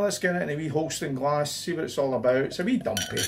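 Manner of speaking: ordinary talk, nothing marked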